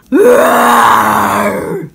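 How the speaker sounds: huge, loud rising roar; lion roaring to drive off the witch; lion's roar. Acted. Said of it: "roared Aslan".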